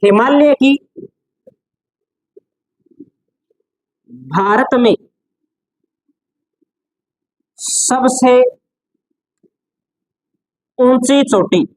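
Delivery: word groups with long pauses between; dictation. Shown as a demonstration. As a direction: dictate for note-taking, phrase by phrase. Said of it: "note लगा करके हम लिख सकते हैं". (श्रुतलेख की तरह रुक-रुक कर)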